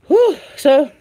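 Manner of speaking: winded from rushing in; breathless after hurrying back; out of breath. Real, not acted. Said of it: "I'm all out of breath".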